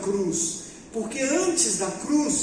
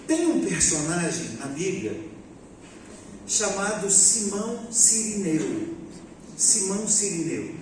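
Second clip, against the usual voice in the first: normal talking voice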